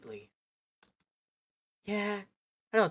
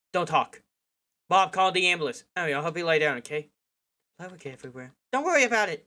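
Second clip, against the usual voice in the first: loud, urgent orders in a crisis; calling out orders over a bleeding friend; urgent commands; 3 semitones higher, 7 dB louder, faster than usual. Acted. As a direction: take charge, fast and loud. Urgent first aid orders.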